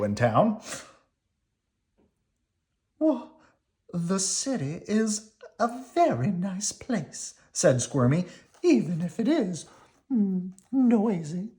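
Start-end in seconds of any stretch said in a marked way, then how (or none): 3.0-7.3 high, slow sweet worm voice; country cousin worm, polite. Acted. 8.6-11.5 country cousin worm, polite. Acted.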